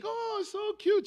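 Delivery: imitating wife's squeal. Husband imitating his wife cooing over the snake, high mock squeal. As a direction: ham up a delighted wife fawning over a pet.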